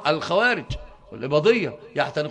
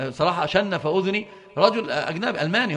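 ordinary delivery

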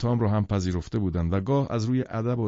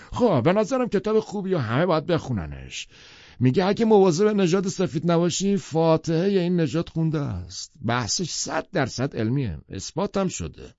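high, emphatic bluster, slowing to a close; Tom, pompous; 9 semitones higher than usual. A narrator's reading. Tom holding forth on his book, pompous. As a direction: preach the theory as settled fact.

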